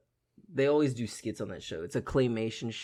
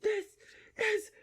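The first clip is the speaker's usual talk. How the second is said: very high, slow, laughing voice; thrilled teen; slower than usual. Retold or imitated. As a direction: squeal with delight as a kid. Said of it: "He's like".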